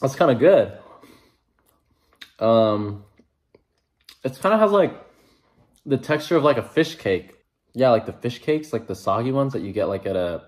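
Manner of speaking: talking between bites; chewing while talking. Real, not acted. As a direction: mouth partly full. Seen 0: Bulging cheek and chewing mouth seen after bite, while commenting.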